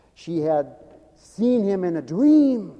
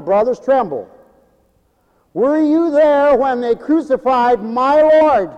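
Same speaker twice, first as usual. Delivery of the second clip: preaching cadence; 6 semitones higher, 6 dB louder than usual. Acted. Quotes spiritual in preaching.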